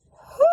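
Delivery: a big exhaled whew; loud relieved breath. Real, not acted. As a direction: blow it out in relief. Relief after the story.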